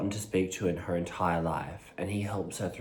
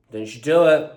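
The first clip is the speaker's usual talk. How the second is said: stern warning; 7 semitones higher, 11 dB louder than usual. Real, not acted. Scolding the barking dog.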